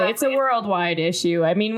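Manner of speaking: usual speaking voice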